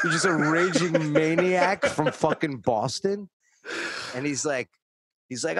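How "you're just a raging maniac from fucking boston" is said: slow, high, loud jeer; loud mocking accusation. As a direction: draw out the insult at full volume. Mocks the driver, co-host laughing.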